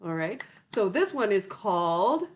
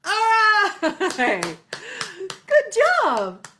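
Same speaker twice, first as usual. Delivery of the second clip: high, louder cheer; excited praise; 10 semitones higher, 6 dB louder, slower than usual. Real, not acted. Teacher praising children after song, excited.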